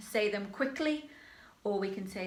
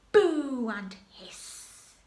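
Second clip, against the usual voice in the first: slow, loud drawn-out boo and hiss; booing the baddie; 3 semitones higher, 6 dB louder, much slower than usual. Shown as a demonstration. Teacher models jeering King Rat, loud and slow. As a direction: jeer the villain, stretching every word. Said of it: "the baddie".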